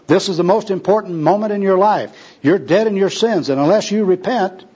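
high-pitched, insistent warning; urgent street preaching. Acted. Gospel worker warns tramp about his sins, urgently. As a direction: press him urgently to repent.

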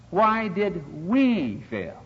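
very slow question leaning hard on WE; stress on WE. Shown as a demonstration. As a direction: stretch and lift the word WE. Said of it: "is very emphatic".

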